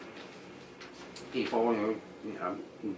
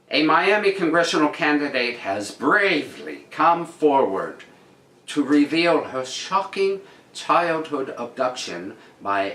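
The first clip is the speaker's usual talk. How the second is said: news read; 5 semitones higher than usual. Acted. Reads the news article aloud.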